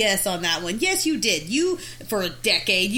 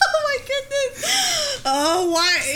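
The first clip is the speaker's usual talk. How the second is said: much higher, slow, through laughter; laughing hard; 14 semitones higher, much slower than usual. Real, not acted. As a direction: gasp it out laughing. Helpless laughter.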